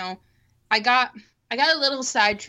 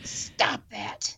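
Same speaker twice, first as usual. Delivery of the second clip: whispered; 10 dB quieter than usual. Acted. Joking reaction to bad band.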